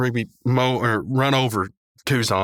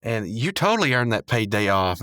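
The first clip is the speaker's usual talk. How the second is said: ordinary talk, nothing marked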